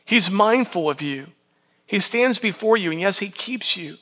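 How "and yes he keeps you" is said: soft, gentle assurance; tender assurance. Real, not acted. Pastor reassuring the congregation, tender and soft. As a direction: say it softly, like a promise. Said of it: "tender assurance".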